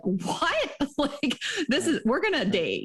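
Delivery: high, laughing disbelief; laughing surprise. Retold or imitated. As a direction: gasp it out in amused shock. Retells her shock on date.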